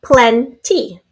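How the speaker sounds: Plenty split into PLEN, pause, TY; in syllables. Shown as a demonstration. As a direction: say Plenty as two separate beats. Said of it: "Now say it in syllables".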